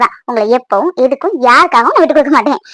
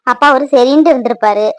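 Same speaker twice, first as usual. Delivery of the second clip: normal talking voice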